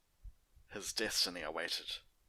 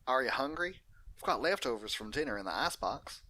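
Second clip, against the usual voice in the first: Applejack voice; 6 semitones higher, 5 dB louder than usual. Acted. Applejack offers leftovers.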